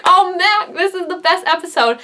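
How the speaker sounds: high-pitched fan excitement; excited reaction. Retold or imitated. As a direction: gush as if reliving the moment. Own excited reaction retold.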